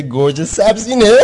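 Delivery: high, loud, slow incredulous question; mock disbelief. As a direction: scoff at the name in disbelief. (ناباوری تمسخرآمیز، زیر و بلند)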